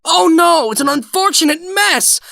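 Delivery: high, loud hammed-up cry of dismay; mock dismay. Demonstrating the spilled cup to OP, mock horrified cry. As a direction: overplay the shock at the spill.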